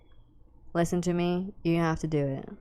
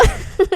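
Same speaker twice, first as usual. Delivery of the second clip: high laugh; 14 semitones higher, 7 dB louder than usual. Real, not acted. Laughing after crash out.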